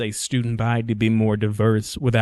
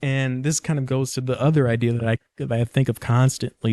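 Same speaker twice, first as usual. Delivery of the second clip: ordinary talk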